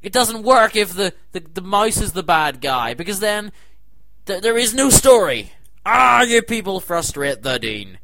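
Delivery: lecturing the complainers, loud and heated; heated rant. Real, not acted. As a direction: keep ranting hard. Continued ranting.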